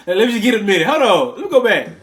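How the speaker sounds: loud, high imitation through laughter; mock apology blurted high and loud, laughing; laughing retelling. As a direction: crack up while quoting the flustered line. Laughing while retelling actor's stumble.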